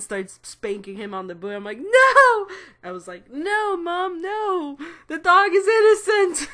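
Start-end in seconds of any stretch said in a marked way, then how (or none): 1.8-2.5 loud, high protest scream; high shouted no. Retold or imitated. Protesting mom spanking dog. 3.3-6.5 high, loud hammed-up pleading; mock melodramatic plea, high-pitched; dramatic plea. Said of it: "I didn't really say that".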